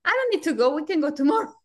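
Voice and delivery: put-on high, light kid's voice; imitating the kid. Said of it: "the kid said, like".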